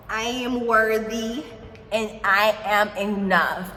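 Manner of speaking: slow, emphatic affirmations with drawn-out words; repeat-after-me affirmations. Shown as a demonstration. Leading Aries viewers through affirmations to repeat, slow and deliberate. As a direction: say each affirmation slowly and firmly for them to echo. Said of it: "repeat after me".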